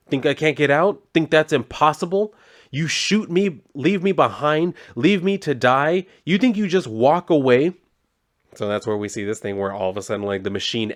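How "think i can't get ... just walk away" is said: higher-pitched acted indignant challenge; retold defiance, building in rising questions; defiant outrage. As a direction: throw each accusation at them as a question. Trapped woman defies those who left her.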